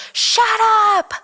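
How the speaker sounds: very high, drawn-out retold yell; mock scream. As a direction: imitate her screaming, voice leaping up. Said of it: "she screams".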